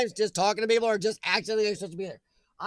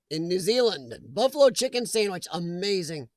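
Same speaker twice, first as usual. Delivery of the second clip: usual speaking voice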